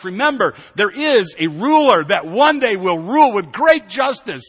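high, loud, rhythmic sermon delivery; impassioned preaching. Real, not acted. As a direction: proclaim it with rising force. Preaching at full force.

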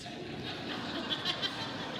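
high airless burst of laughter; a real laugh slipping out; quick unvoiced giggle; breathy laugh. Real, not acted. Laughs at his own joke.

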